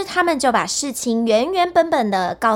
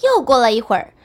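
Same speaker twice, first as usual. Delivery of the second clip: usual speaking voice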